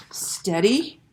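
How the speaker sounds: plain reading voice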